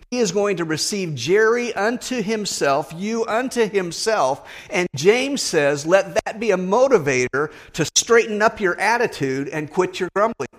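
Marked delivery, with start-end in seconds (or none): none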